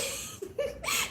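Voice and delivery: squealing laugh; high-pitched laughing squeal; high squeal of laughter. Real, not acted. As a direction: crack up at the story. Laughing at mom's blunder.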